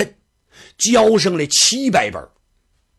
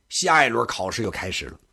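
ordinary delivery

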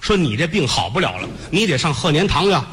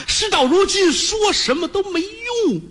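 despairing son; slower than usual. Acted. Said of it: "娘啊，娘啊".

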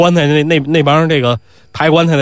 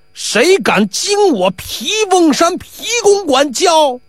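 outraged boast; 12 semitones higher, much slower than usual. Acted. Pi Wu's outraged shout.

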